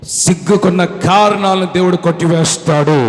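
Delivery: loud, weighty preaching voice; low-pitched, emphatic sermon delivery. Real, not acted. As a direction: proclaim it forcefully to the congregation. Preaches to congregation.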